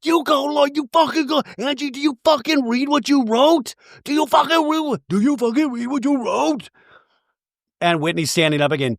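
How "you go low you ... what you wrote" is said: high, strained yell held back in volume; hushed yell. Retold or imitated. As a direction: scream at her while trying to stay quiet. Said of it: "she's trying to now yell, but be quiet".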